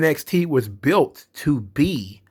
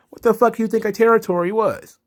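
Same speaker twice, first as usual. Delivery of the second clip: exasperated outburst; 9 semitones higher, 4 dB louder than usual. Real, not acted. Exasperated rant at fans' complaint.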